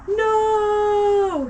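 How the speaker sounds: loud high cry; shouting. A narrator's reading. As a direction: shout it in alarm. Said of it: "he shouted".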